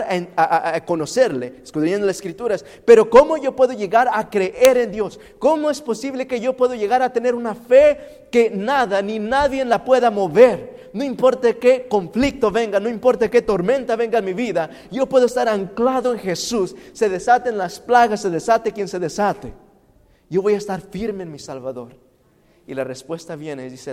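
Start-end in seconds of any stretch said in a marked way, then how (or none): 2.8-5.2 high, loud preached question; louder question. Acted. 5.4-22.0 loud, high preacher build; full-force sermon crescendo; impassioned preaching. Acted.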